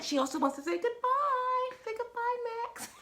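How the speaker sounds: very high, cutesy pet voice; talking to a pet. Real, not acted. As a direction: coo it high for the cat. Talking for and to cat.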